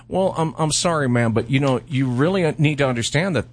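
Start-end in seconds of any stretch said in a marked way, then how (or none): none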